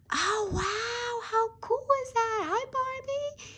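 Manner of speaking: very high, delighted doll voice. Pretend. Doll greets the new Barbie, very high and delighted. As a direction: squeal hello like a thrilled toy.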